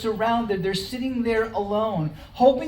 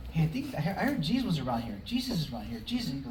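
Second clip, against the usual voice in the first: low, soft crowd murmur; little chatter; 5 semitones lower, 8 dB quieter, faster than usual. Acted. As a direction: pass the rumor along under your breath. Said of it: "the little chatter".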